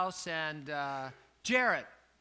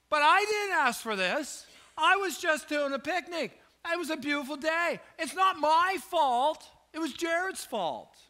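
whiny protest; 9 semitones higher, 4 dB louder than usual. Retold or imitated. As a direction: play the sulky patient who insists it's not his fault. Retold whining protest to doctor.

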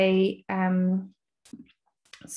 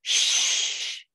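shushing. Acted. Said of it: "says Bat".

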